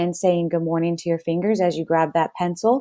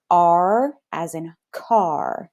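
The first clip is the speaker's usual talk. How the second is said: R as in car said slowly, R drawn out; slow teaching model; much slower than usual. Shown as a demonstration. Teacher modeling R as in car slowly for kids. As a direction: stretch each word for the class. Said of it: "Say this with me".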